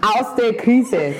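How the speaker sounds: put-on Bavarian politician accent. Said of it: "die Politiker aus Bayern, die sprechen immer so".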